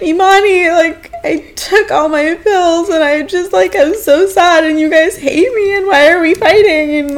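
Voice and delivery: high, whiny imitated sobbing; mock crying. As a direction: wail it like a weepy drunk, hammed up. Voices own drunk crying self.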